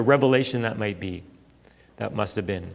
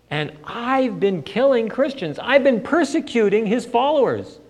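lightly voiced, a bit higher dawning horror; a hint of stunned Saul; 8 semitones higher than usual. Saul realizing Jesus is the Messiah, lightly voiced dismay. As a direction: let the realization sink in slowly.

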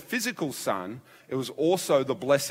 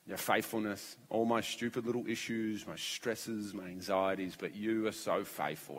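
soft, lowered voice in worship; prayerful wonder; 3 semitones lower, 5 dB quieter than usual. Retold or imitated. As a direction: quietly confess to God in awe. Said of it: "I worship God along with you".

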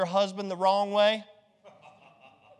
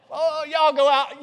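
laughing; 8 semitones higher, 7 dB louder than usual. Real, not acted. Laughing at congregation.

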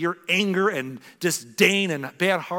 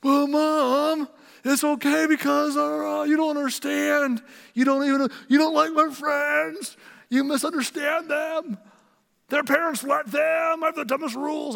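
put-on high, whiny teenage complaint, slowed and louder; whiny teen arguing; 13 semitones higher, 5 dB louder than usual. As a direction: play the stroppy teenager arguing with mom, pitched up. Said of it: "I argued with her".

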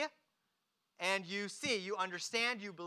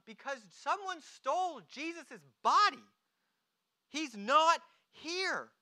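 higher-pitched, emphatic acted protest; exasperated answer; 7 semitones higher, 5 dB louder than usual. Mary answers why she is weeping, exasperated. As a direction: answer as an upset Mary, voice raised.

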